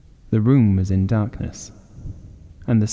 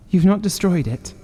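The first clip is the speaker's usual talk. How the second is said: anxious high voice; 9 semitones higher than usual. Acted. Wife, high and urgent.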